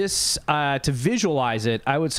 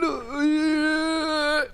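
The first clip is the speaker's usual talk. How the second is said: butler voice; 14 semitones higher than usual. Acted. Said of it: "And he went".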